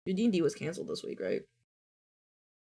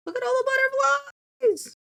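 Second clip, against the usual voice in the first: high, delighted squeal; sudden high-pitched excitement; excited squeal; 5 dB louder, much faster than usual. Real, not acted. Excited game moment.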